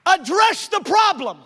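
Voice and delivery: loud, high, slowed exhortation; full-force preaching. Real, not acted. Preacher pressing the congregation to face their problem, loud. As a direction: drive the point home from the pulpit.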